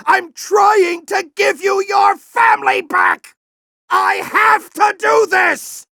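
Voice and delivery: high, slow, shouted; desperate shout. Acted. General yelling at Ratchet to let him save the Lombaxes. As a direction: drag out every word in a desperate yell. Boss fight, justifying himself.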